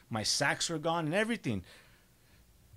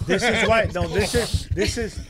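laughing through the words, louder; 6 semitones higher, 7 dB louder than usual. Real, not acted. Defending himself to friends who tease him, laughing. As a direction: protest through real laughter.

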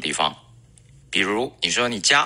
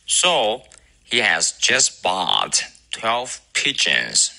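slow, careful recitation; 2 semitones higher, much slower than usual. Shown as a demonstration. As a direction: recite slowly for students to copy. Recites the model sentence.

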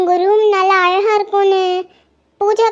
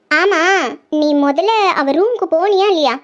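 normal talking voice

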